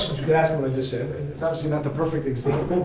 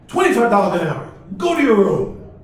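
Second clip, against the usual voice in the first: angry father shout; 3 semitones higher, 7 dB louder than usual. Retold or imitated. Father yells answer at son.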